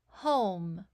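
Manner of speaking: ordinary delivery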